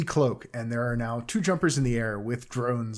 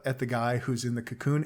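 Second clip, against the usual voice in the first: usual speaking voice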